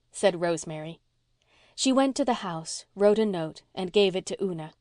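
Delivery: ordinary delivery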